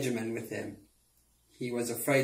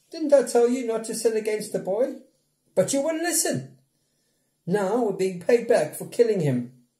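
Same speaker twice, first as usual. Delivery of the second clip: high, loud reproachful voice; reproachful Reuben; 9 semitones higher, 5 dB louder than usual. A narrator's reading. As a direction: scold the brothers bitterly.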